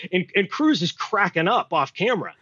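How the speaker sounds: ordinary talk, nothing marked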